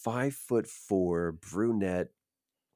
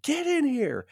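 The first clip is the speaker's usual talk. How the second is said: high, loud, irritated yell; pissed-off order; 6 dB louder, slower than usual. Retold or imitated. Casting agent ordering the actor into the office, pissed. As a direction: yell the order, imitating an angry boss. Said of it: "She's, like, still pissed".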